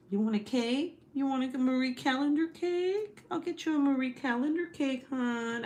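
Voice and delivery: sweetly sarcastic, like a waitress; wife teasing husband, sarcastic sweetness; mock sweet offer. Real, not acted.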